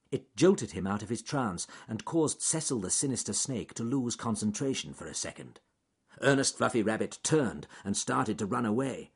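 plain reading voice

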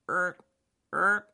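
high croaking erk; frog croak. Acted. Froggy croaks by the pond, high.

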